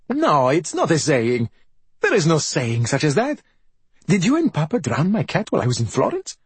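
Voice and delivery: exasperated, rising challenge; indignant confrontation. A narrator's reading. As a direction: press the accusation, pitch swooping up to a question. Son confronts mother over drowned cat.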